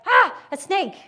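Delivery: high, loud startled yelp at a snake; a panicked lizard brain spotting a snake; startled fright. Acted. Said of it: "The amygdala is like".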